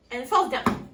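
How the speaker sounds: ordinary delivery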